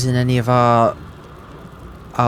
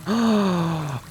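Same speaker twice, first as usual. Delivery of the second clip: sharp gasp of delight; surprised gasp; 8 semitones higher, 4 dB quieter than usual. Real, not acted. Spell slots came back.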